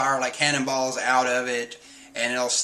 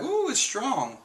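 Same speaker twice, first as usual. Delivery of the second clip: impressed ooh; 12 semitones higher, slower than usual. Retold or imitated. Crew impressed by bubble coating.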